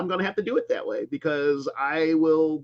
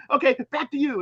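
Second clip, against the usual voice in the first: higher-pitched imitated anchor handoff; mock newscaster; 8 semitones higher, faster than usual. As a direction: lightly play a newscaster returning to normal. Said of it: "she's doing a newscast".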